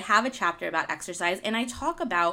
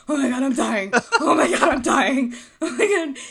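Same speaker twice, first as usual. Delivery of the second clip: higher and louder, mock panic broken by laughter; laughing mock panic; 5 semitones higher, 7 dB louder than usual. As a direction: laugh while imitating your own panicked gasping. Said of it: "I was like".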